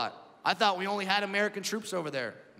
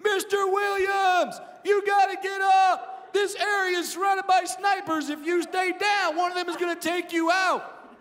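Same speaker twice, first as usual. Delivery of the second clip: high, loud urgent shout; soldier yelling; 6 dB louder than usual. Retold or imitated. Soldier shouts a warning at the comic. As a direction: bark the warning like a soldier under fire. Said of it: "one of the soldiers yells at me".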